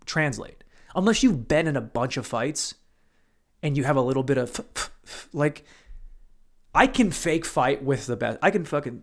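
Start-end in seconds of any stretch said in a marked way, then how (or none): 4.5-5.3 short voiceless puffs of air; mouth-made jab noises; imitated punch noises. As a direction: puff out quick punch sounds.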